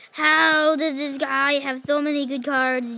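raging gamer. Real, not acted. Raging at opponent.

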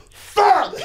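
sudden high, loud curse; costumed mascot cursing after a kid's slap, high loud yelp; pained yelp. Retold or imitated. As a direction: yelp it like you just got slapped in the head. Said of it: "you hear the guy go".

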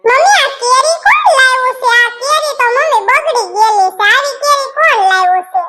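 put-on a little girl yelling about rotten mangoes; shrill little girl.

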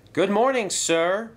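high, loud chorus of engines. A narrator's reading. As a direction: answer brightly as a group of engines. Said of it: "replied the engines".

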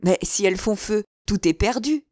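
loud alarm, rising in pitch; exclaiming. A narrator's reading. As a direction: cry out the warning, climbing toward the end. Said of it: "s'écria d'Artagnan".

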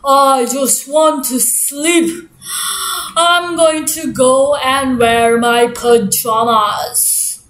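low, slow weary grumble; weary bear. Acted. Bear leaving to put on pajamas, slow weary grumble. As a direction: drag every word like you're half asleep. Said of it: "Mister Bear was like".

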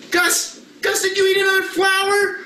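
hammed-up shock at the puppet; mock alarm. Pastor to the puppet dog, hammed-up alarm.

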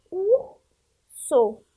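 "urso" very slowly, long breathy R, then the last syllable; syllable demo. Shown as a demonstration. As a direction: sound out "urso" in two separated syllables. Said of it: "pra formar urso, falta o quê". ("urso" bem devagar, com o R soprado e as sílabas separadas)

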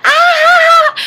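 high, loud imitated cackle; mom's hysterical laugh, imitated. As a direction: shriek with mock laughter. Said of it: "is laughing hysterically".

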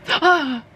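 big theatrical gasp; mock gasp. Reacts to missing study model.

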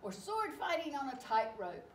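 usual speaking voice

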